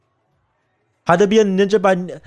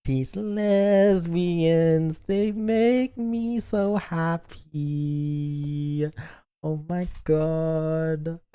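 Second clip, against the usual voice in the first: slow, soft and heartfelt; touched; 7 dB quieter, much slower than usual. Real, not acted. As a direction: melt over the couple, slowly and softly. Moved by ending scene.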